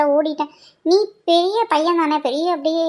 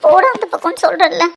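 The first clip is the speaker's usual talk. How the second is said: crying in pain; 2 semitones higher, 5 dB louder than usual. Acted. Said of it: "ஏன்டி இப்படி அழுகுற?".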